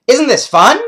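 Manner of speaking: high, loud happy collie voice; high loud cartoon dog, teasing; excited dog. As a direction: bounce it like a dog who loves hikes. Dog Butch wrestling boys.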